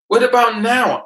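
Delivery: high, loud demand; loud emphatic retort. Retold or imitated. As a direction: shout the point home.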